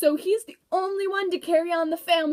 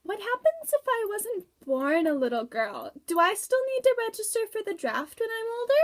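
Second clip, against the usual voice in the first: put-on high child voice, rising higher; girl in the front row asks in a high little-girl voice; 6 semitones higher than usual. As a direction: play a small girl asking the speaker a worried question. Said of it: "you little girl in the front row".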